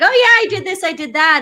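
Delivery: bright high put-on cheer, hiding it; fake cheerful. To her roommate, hiding depression, fake cheerful. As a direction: brush them off with a breezy lie. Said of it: "I would just kinda lie and be like".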